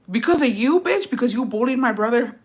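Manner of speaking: high, indignant questioning; retold confrontation. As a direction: replay an accusing challenge.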